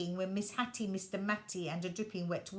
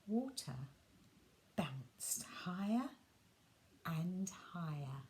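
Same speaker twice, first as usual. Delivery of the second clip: whispered build; 4 semitones lower, 6 dB quieter than usual. A narrator's reading. Bouncing narration whispered.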